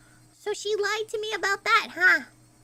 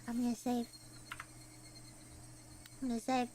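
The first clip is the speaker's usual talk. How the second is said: low, soft and deflated; subdued mumble to oneself; reluctant resignation; 10 semitones lower, 12 dB quieter, faster than usual. Real, not acted. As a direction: say it quietly, giving in. Reluctantly saving the game.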